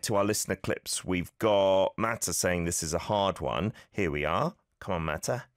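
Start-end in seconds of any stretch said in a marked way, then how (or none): none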